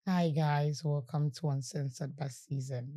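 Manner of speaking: a soft, deliberate show greeting; gentle intro. Shown as a demonstration. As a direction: ease into it gently and intentionally. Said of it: "You see how gentle that was?".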